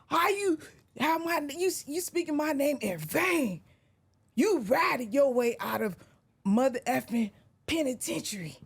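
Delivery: imitating [name]. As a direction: do the rival rapper ranting on camera. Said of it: "try to say it as best as he said".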